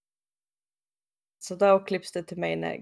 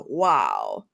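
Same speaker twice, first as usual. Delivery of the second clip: low impressed wow; 12 semitones lower than usual. Retold or imitated. Hale touching new body.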